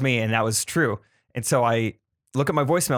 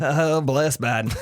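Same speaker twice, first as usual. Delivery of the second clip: light put-on Southern accent; folksy Southern twang, lightly done; slower than usual. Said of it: "if you say it in a Southern accent".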